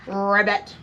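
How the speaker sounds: low ribbit with a rolled r; pig croaking like a frog. Acted. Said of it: "all the little pig said was".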